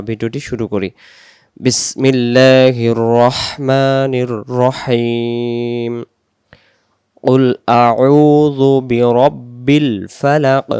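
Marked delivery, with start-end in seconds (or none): 1.6-5.9 slow measured recitation with drawn-out vowels; Quran recitation. Real, not acted. Teacher opening the lesson with bismillah, slow recitation. 7.2-10.7 slow, elongated recitation of an Arabic verse; Quran recitation. Shown as a demonstration. Teacher reciting the first verse for learners, slow recitation.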